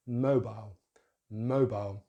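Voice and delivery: mobile as MOH-bile, first syllable stressed; British pronunciation. Shown as a demonstration. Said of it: "with stress on that syllable".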